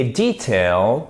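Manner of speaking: detail as DEE-tail, drawn out; long, stressed DEE at the start of detail; British pronunciation. Shown as a demonstration. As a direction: slowly model British detail. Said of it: "le détail en version britannique".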